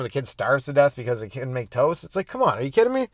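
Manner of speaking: ordinary delivery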